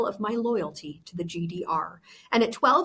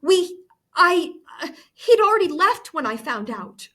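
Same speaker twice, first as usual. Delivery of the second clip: stammering; 7 semitones higher, 6 dB louder than usual. A narrator's reading. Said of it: "I stammered".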